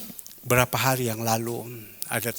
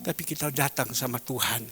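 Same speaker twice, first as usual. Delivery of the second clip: ordinary talk, nothing marked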